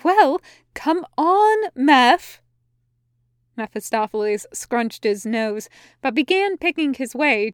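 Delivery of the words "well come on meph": loud, high, drawn-out urging; exasperated hurry-up. A narrator's reading. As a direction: call out impatiently, stretching the words. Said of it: "raising her arms in exasperation".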